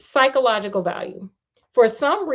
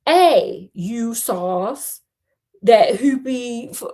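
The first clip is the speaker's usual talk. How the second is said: attempted British accent, louder opening; 5 dB louder, much slower than usual. Shown as a demonstration. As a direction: try on a shaky British accent. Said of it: "in my British accent. Did you wanna hear it like that?".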